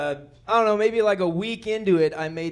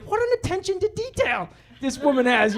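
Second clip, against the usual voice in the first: high, gushing admiration; mock admiring; 8 semitones higher than usual. Husband admiring wife's homemade label, gushing high. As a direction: marvel at her, hammed up.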